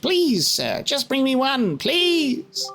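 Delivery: high, eager, fawning little man; Tingle voice. Acted. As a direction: gush like an odd fairy fan. Voicing Tingle addressing fairy.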